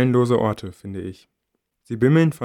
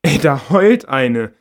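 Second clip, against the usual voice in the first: slow, louder mocking remark; slow loud teasing voice; mocking girl; 3 semitones higher, 9 dB louder, much slower than usual. A narrator's reading. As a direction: point out the crying girl to a friend, mocking. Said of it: "sagt das andere Mädchen".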